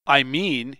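usual speaking voice

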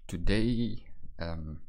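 unsteady, grainy morning voice; just-woke-up wobbly voice; shaky voice. Real, not acted. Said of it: "my voice is shaky for some reason".

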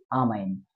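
"आ" said as an open "aa" before man; article as "aa". Shown as a demonstration. Teacher answers own question for learners, "आ" as open "aa". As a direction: open "aa" for the article in "आ man", pitch dropping low. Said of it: "आ man बोलेंगे".

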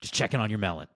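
bad impression of a coach's voicemail; hammed-up coach checking in by phone message; Coach Aliotti. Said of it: "worst Coach Aliotti impression".

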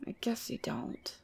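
soft, disappointed whisper. Acted. The demon to herself when the listener forgets the pact, whispered. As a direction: say it under your breath.

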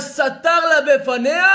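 high, loud, drawn-out declamation; Midrash proclamation. A narrator's reading. Preacher announces the slap from the Midrash, proclaiming loudly. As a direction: slowly trumpet the climax of the tale.

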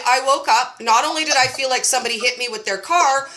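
normal talking voice

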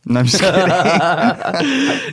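high, stretched laughing words; giggly, drawn-out kidding; laughing tease. Real, not acted. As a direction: laugh it off as a joke. Teasing about the accent, laughing.

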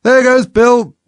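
high, loud cry of the crowd; excited high-pitched holler; chorus shout. A narrator's reading. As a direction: yell it out as an onlooker. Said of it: "a general chorus of".

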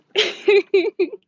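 short high burst of laughter. Real, not acted. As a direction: laugh it off, amused. Laughing at the messy store.

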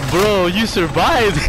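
slow, loud amazed shout; excited. Real, not acted. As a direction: shout it in disbelief and joy. Stunned joy after the win.

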